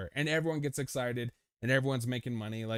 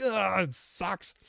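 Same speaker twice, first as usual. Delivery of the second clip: mock groaning complaint; 7 semitones higher, much slower than usual.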